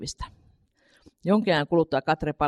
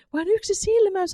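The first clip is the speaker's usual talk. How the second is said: Katri thinking. A narrator's reading. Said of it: "hän ajatteli".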